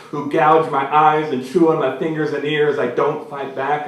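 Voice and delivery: plain reading voice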